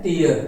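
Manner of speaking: टीयर with an ee sound; the weeping sense, टीयर with long ee; pronounced teer. Shown as a demonstration. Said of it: "इसका पढ़ते हैं इसको टीयर".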